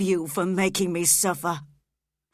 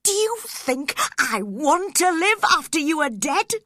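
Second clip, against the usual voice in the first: loud, high, slow and pained; anguished outburst; 9 semitones higher, 7 dB louder than usual. A narrator's reading. Heathcliff blaming Catherine for leaving him, anguished. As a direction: pour out the hurt and blame.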